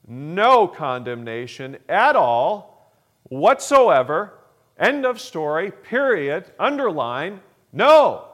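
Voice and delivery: emphatic building proclamation. Real, not acted. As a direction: build it up word by word until the last no rings out. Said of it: "that's the Pastor Howard version".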